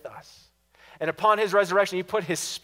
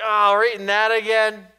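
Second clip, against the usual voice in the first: mock grumbling; 4 semitones higher, 8 dB louder than usual. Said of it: "it's like".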